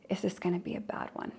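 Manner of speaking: hushed, fast dread under the breath; anxious whisper. Retold or imitated. Person with childhood threat cycles bracing at the lever, anxious whisper. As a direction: whisper the fear to yourself.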